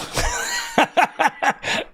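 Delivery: high squealing fake laugh; mock shrieking laugh. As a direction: burst into a shrill mock laugh. Barmaid laughing at his accent.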